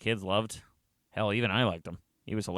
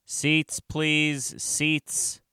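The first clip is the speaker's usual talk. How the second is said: higher-pitched classroom call; teacher calling for seats; 7 semitones higher, much slower than usual. Acted. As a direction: herd pupils to their seats.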